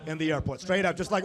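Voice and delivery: ordinary talk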